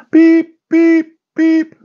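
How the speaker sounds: very high squeaky electronic beeps; tiny high beep-beep; imitated beep. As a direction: chirp it like a baking machine. Imitating the bread oven beep.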